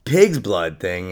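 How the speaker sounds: ordinary talk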